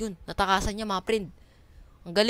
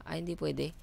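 ordinary talk

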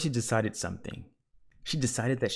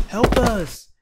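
loud, high, desperate call; calling for help; 13 semitones higher, 11 dB louder than usual. A narrator's reading. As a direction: call out for rescue from far. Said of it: "Missus Watson called".

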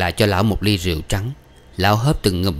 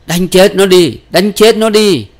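high, loud rallying cry; shouting oneself hoarse; hoarse-voiced yelling; 10 semitones higher, 9 dB louder, slower than usual. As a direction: yell it out over the brawl. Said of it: "Tiếng bác khản đi".

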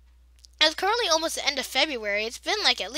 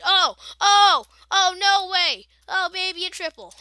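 excited; 5 semitones higher, 8 dB louder than usual. Real, not acted. Pulls off a triple kill.

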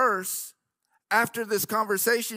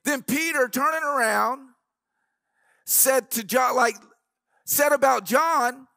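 rising, louder sermon delivery with organ behind; preaching at full force; 4 semitones higher, 4 dB louder than usual. Acted. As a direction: drive the point home to the congregation.

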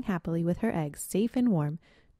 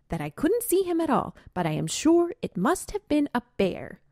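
put-on higher, dramatic young skunk; a small skunk spinning a scary tale; storytelling skunk; 8 semitones higher than usual. Spotted Skunk tells the family.

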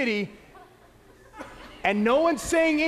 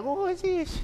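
higher, quiet, curious busybody; nosy gossip; 6 semitones higher, 4 dB quieter than usual. Retold or imitated. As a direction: peek in like a nosy neighbour.

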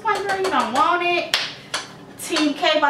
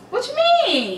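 incredulous; 11 semitones higher than usual. Real, not acted. Incredulous high question.

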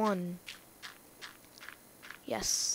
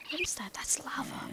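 whispering; 4 semitones higher, 7 dB quieter than usual. Real, not acted. Spotting lava in the dark.

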